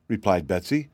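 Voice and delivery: plain reading voice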